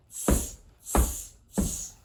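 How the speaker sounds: cheeky monkeys tutting back; monkey tsk-tsk. A narrator's reading. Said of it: "and said".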